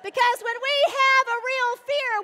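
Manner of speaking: normal talking voice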